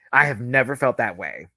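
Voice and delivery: usual speaking voice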